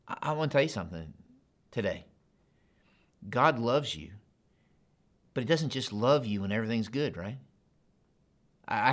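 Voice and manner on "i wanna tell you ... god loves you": ordinary delivery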